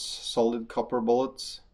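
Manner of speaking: ordinary talk, nothing marked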